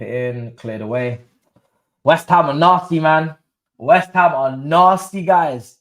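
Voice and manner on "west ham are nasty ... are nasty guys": loud, drawn-out, hyped-up praise; excited outburst. Real, not acted. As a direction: shout it out slow and thrilled. Reacting live to the match.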